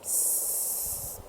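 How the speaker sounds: tss as a soft, thin hiss of air; subtle tss. Shown as a demonstration. Coach showing the right hiss, a soft subtle tss. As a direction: let out a gentle tss. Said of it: "a nice subtle tss".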